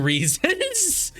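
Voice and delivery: boastful killer. Acted. Reads the game's dialogue aloud.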